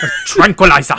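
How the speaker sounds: put-on proper, loud German doctor accent; mock Nazi doctor, loud and proper. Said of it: "He talks like this".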